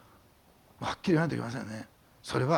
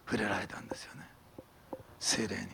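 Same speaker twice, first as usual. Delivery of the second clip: hushed whisper; 6 semitones lower, 10 dB quieter than usual. Acted. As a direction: breathe it out in awe. Moment of being touched by Spirit.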